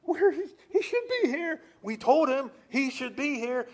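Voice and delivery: high, loud panicked cry; panicked parents. Acted. Parents who lost their son, calling out in a high panic. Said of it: "they're kinda like".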